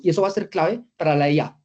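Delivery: ordinary talk, nothing marked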